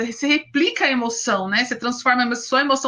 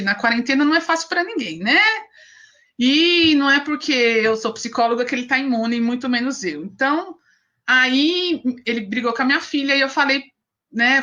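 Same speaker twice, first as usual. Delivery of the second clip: ordinary talk, nothing marked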